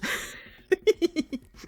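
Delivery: high, loud burst of giggling; giggle. Real, not acted. Reader cracks up at the knife threat, giggling. Own laugh after the threat.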